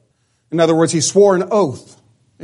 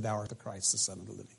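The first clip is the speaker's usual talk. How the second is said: a touch of hush; 9 semitones lower, 22 dB quieter, much faster than usual. Retold or imitated. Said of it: "he repudiated everything he said".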